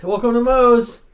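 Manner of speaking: loud, high cashier greeting; shop greeting. Pretend. Cashier greeting customers, loud and high. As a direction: bellow the shop welcome.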